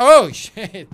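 sudden loud high yelp of fright; startled yell. Real, not acted. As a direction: jump out of your skin and shout it. Jump scare in horror game. (grito de susto repentino)

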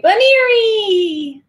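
excited announcement. Real, not acted. Announcing a gift to viewer.

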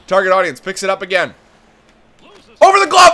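high, loud running commentary with shouted peaks; excited announcer narrating a scramble; play-by-play call. Acted. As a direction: call every pass like a live broadcaster. Sustained play-by-play of the rush.

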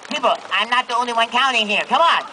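normal talking voice

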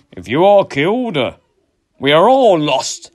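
high, loud, slowing plea; a warrior begging his leader to stay; Sven's plea. Acted. Sven pleads with Eric.

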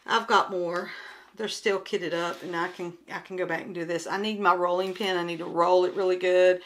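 ordinary talk

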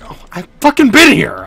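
loud startled exclamation; surprised shout. Real, not acted. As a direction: yell it in sudden recognition. Sudden recognition while playing.

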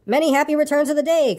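high, cheery call across a river; bright-hearted, high-pitched hail. A narrator's reading. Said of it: "called out Pooh".

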